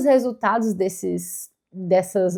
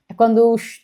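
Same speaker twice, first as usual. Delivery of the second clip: ordinary talk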